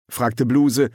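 usual speaking voice

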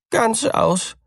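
high, frightened mouse voice; the name slips out; mouse blurting. A narrator's reading. Mouse to cat, high and frightened.

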